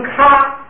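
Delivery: 咔 on a high level tone, still aspirated; 高平调. Shown as a demonstration. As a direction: hold 咔 high and even with a puff of air. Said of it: "既变成高调". (高平调送气地念“咔”)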